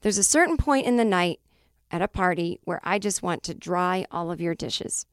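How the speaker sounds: ordinary talk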